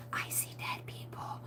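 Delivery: breathy movie-quote whisper; spooky whisper. Pretend. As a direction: whisper it like a creepy ghost-movie kid. Quoting the ghost movie line.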